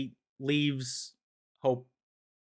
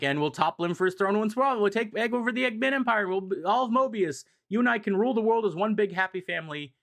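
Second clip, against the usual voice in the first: higher and fast, a scheming brother's sales pitch; grandiose villain pitch; 7 semitones higher, faster than usual. Retold or imitated. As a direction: rattle it off like a giddy schemer selling a dream. Snively pitching world domination to Hope.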